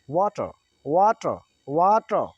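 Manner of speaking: slow American वाटर with no final R. Shown as a demonstration. Teacher modelling for students, American tone. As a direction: say वाटर American-style, open ending. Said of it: "American tone क्या होगी?".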